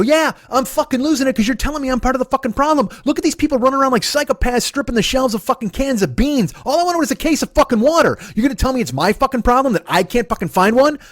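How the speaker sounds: high, heated tirade; angry yelling. Retold or imitated. Brother says he's losing it, yelling back. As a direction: re-enact losing it, pitch held way up. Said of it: "you should probably stop yelling".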